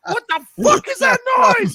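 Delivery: loud high outraged yell, slowed; mock shout. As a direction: bellow in disgust at the noise. Stranger reacting to eating noise.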